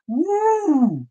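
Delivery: high whooping cheer. Real, not acted. Host cheering at his own joke, high whoop. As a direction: let out a happy whoop.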